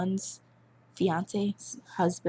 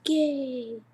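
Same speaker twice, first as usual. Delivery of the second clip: flamboyant exclamation; 7 semitones higher, 5 dB louder than usual. Retold or imitated. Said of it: "He's like".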